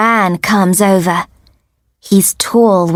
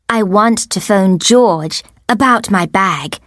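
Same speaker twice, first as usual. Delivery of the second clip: plain reading voice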